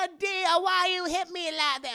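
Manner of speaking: high screechy whine; hammed-up screeching aunt; screeching Hattie voice. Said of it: "She has just a screeching".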